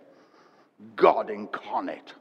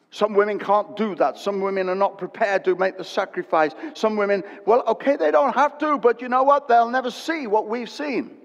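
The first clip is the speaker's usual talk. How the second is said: louder, higher preacher's build; preaching at full force; 9 semitones higher, 5 dB louder than usual. Acted. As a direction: drive it home to the congregation. Preaching climax, rising intensity.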